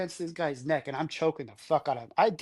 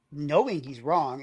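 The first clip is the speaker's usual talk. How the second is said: ordinary delivery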